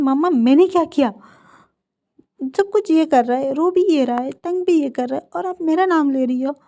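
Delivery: hammed-up whiny sibling protest; indignant protest. A narrator's reading. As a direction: complain it's not fair, voice up. Brother protests unfair blame.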